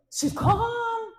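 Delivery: a laughing, high-pitched calm; high mock calm.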